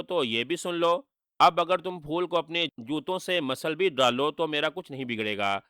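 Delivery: ordinary delivery, nothing marked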